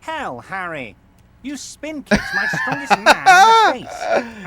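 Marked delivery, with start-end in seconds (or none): 1.4-4.5 much louder, higher outburst; incredulous outburst. Acted. Boss telling Harry he saw the spin kick, loud and amazed. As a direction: blurt it out in disbelief.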